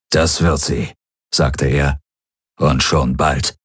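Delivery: put-on low, grim promise from an old commander; a lightly voiced stern warlord. Warlord grimly promising Galen revenge, low voice.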